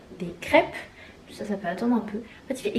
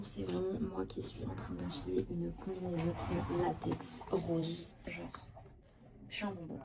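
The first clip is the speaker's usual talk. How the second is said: whispering; 3 semitones lower, 18 dB quieter than usual. Real, not acted. Low voiced aside about latex suit.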